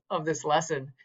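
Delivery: ordinary talk, nothing marked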